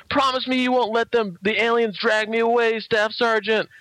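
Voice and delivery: high-pitched mock plea; pleading soldier. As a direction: beg your sergeant like a frightened recruit. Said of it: "the one soldier goes to Eckert".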